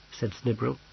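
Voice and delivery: ordinary delivery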